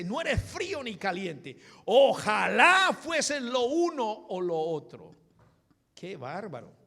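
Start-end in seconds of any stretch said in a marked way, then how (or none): 0.0-5.1 loud, high shout that stretches into the wish; forceful rebuke. Acted. Preacher as the Lord rebuking a lukewarm church, shouting. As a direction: cry it out like a thundering preacher. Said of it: "ahí comienza el Señor a darle fuerte".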